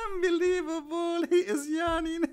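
very high, squealing mock devil; gleeful Satan. Imitating Satan laughing at a yawner, high gleeful squeal. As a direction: squeal it through mocking laughter. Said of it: "Satan laugh at him".

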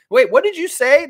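high, loud mock disbelief; hammed-up outraged double take; shocked coach. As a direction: squawk it as an affronted coach. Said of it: "The coach says to Fiercely".

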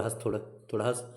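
usual speaking voice

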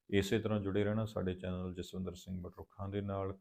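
ordinary talk, nothing marked

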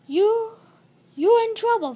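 loud, high-pitched telling-off; story yell. A narrator's reading. A character telling Flynn off for trouble, yelling. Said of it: "yells the top of her".

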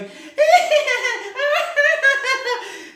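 high, loud squealing laugh imitation; imitated high cackle. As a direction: mimic her shrieking laugh. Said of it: "she's going".

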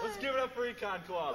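hype call. Real, not acted. Calling for applause for club.

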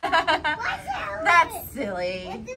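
slow giggly words; real delighted giggle; laughing. Real, not acted. Child laughing at play.

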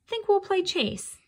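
a smug cat itching for a chase; cocky cat. A narrator's reading. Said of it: "he said, wiggling his ears".

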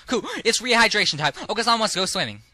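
high, loud cartoon bird voice; excitable bird character; hyper bird. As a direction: squawk it with hyperactive zeal. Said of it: "He's, like, hyper".

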